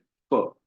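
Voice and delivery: the common wrong "फ", teeth on lip; English-style f. Shown as a demonstration. Teacher shows class the wrong English f sound. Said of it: "तो फ गलत उच्चारण है".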